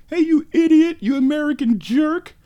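high squeaky angry yell; silly clown. Pretend. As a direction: shout like a cartoon driver. Angry driver yelling at him.

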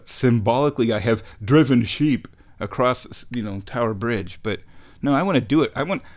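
ordinary delivery

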